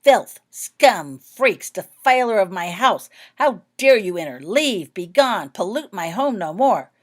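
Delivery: put-on loud, raging old woman hurling insults; furious shouting portrait. A narrator's reading. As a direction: rant at the intruders at full volume. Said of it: "quit screaming and started shouting".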